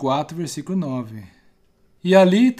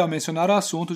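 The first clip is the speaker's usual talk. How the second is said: ordinary talk, nothing marked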